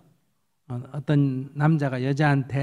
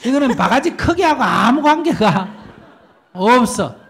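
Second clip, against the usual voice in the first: higher and louder, insisting; emphatic, raised voice; 7 semitones higher, 7 dB louder than usual. Acted. Preacher to the congregation, raising a higher, louder voice. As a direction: drive it home with a raised, higher voice.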